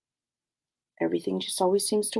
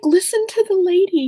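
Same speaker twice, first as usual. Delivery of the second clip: higher and louder, a loving father's urging; dad's voice; 12 semitones higher, 6 dB louder than usual. Retold or imitated. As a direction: play the sweet dad, high and loud. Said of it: "He's like".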